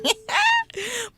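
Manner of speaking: sudden high-pitched laugh; shrieking laugh at a comment. Real, not acted. Host laughs at listener message.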